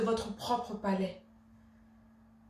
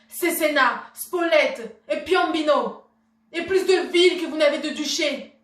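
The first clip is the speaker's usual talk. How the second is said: raised, forceful, insistent listing; proud indignation; 4 semitones higher, 6 dB louder than usual. Acted. Wife reminding her husband of her dowry, proud indignation. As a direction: hammer out each item in high indignation. Wife lists what she brought him.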